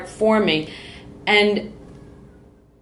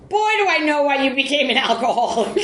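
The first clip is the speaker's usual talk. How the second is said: laughing quote; 6 semitones higher than usual. Retold or imitated. Quoting friend, laughing.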